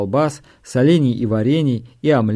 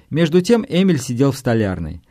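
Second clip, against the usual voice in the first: usual speaking voice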